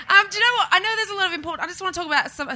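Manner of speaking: ordinary talk, nothing marked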